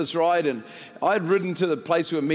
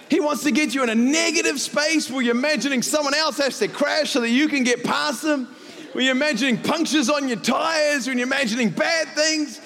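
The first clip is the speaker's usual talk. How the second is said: high, urgent, sustained sermon delivery; impassioned preaching; 10 semitones higher than usual. Real, not acted. Pastor warning that the devil trades in darkness, impassioned preaching. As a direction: drive the point home to the congregation.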